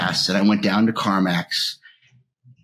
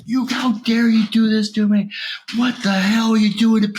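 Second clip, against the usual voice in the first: re-enacting screaming in protest; retold furious yell; 12 semitones higher than usual. Screaming at the insurance people for cutting treatment, furious. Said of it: "I was screaming at them".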